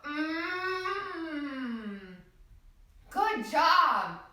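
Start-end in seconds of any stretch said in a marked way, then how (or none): 0.0-2.0 soft hummed mmm; yummy mmm. Shown as a demonstration. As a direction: hum like tasting something yummy. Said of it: "Can you go".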